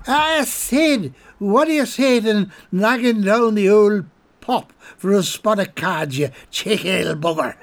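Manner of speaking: put-on high, loud tipsy Irish drunk; sway into it like a merry drunk; drunk Colm. Drunk Colm hailing the guard post, high and tipsy. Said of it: "he inquired".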